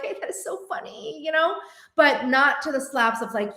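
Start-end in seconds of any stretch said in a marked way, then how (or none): 0.0-1.2 mock friendly teasing. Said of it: "We're like".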